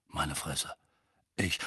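low, feeble mumble; faint. A narrator's reading. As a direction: trail off weakly, stunned. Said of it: "sagte Mandangus matt".

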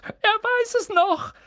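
very high, slow and delighted squeal; entzückter Ausruf. Acted. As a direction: crow it with delight. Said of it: "rief Daedalus Dickel". (Dickel, sehr hoch und langsam vor Entzücken)

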